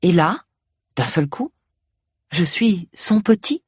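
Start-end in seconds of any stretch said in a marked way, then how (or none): none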